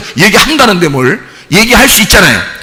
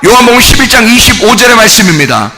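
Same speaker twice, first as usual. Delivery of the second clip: fervent sermon, half shouted; preaching at full force; 5 semitones higher than usual. Acted. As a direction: drive each point home to the pews. Preaching to the congregation.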